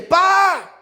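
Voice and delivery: put-on high loud child's call for dad. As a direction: call out like a lost little boy. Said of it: "me llama como buscándome".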